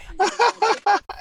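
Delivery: sudden high laugh; high burst of laughter. Real, not acted. Guest cracking up at the host's bad accent, laughing high.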